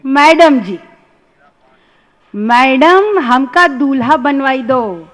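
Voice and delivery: rustic student pleading in measured verse; comic poem recital. Acted. Student begging his English teacher for help, comic poem recital. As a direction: recite it in Hindi dialect, leaning on the openings.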